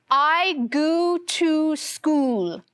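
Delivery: "I go to school" with every O said oo: "I goo too school"; silly oo-for-every-O reading; all O's as oo. Shown as a demonstration. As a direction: stretch each O into an oo. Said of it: "with all of the O's saying the oo sound".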